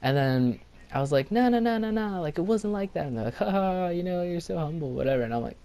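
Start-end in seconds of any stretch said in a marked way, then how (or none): 1.3-3.1 high, hammed-up modest protest; protesting denial. Storyteller waving off the guys' rumors, high mock protest. 3.4-4.9 high mock-admiring voice; imitated fake laugh into high-pitched praise; teasing guys. Guys on shuttle praising his modesty, high teasing mimicry.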